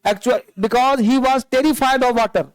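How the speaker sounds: slow, careful reading; measured classroom reading; slow read-aloud. Shown as a demonstration. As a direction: draw the line out for the class. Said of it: "क्योंकि पूरा कहानी past में होता है".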